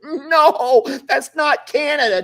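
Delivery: hammed-up disbelief, laughing it off; mock dismay. Host pretends shock that Canada is hot, high and loud.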